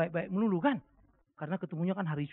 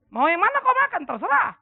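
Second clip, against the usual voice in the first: higher, loud scolding; stern threat; 12 semitones higher, 10 dB louder than usual. Retold or imitated. Father threatening his child over vegetables, loud scolding. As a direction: threaten the kid, mock-fierce.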